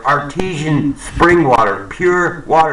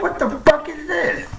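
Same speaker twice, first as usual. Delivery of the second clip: much higher, squeezed disbelief; incredulous outburst; 5 dB quieter than usual. Retold or imitated. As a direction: react like you can't believe the call. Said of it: "I'm like".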